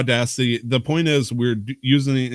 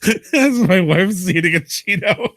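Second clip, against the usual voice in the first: laughing while talking; 11 semitones higher than usual. Real, not acted. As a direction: talk through real laughter. Laughing at wife's Cheeto.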